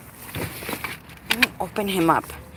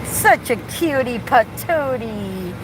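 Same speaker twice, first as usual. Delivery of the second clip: cooing to car; much slower than usual. Real, not acted. To her car as it starts, slow tender cooing. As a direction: coo it like to a pet.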